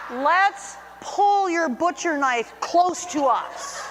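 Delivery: slow, hammed-up sweet talk, laughing; mock cooing to a toddler. Guest coos at the toddler about the knife, laughing. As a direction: coo it like baby talk, slowly. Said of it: "what I've been saying to this little girl".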